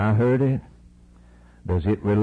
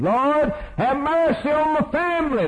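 mock prayer. Mocking a half-hearted prayer.

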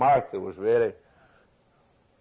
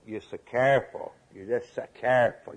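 normal talking voice

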